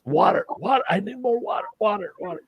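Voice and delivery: frantic yelling. Retold or imitated. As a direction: beg like your mouth's on fire. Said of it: "yelling at the waitress".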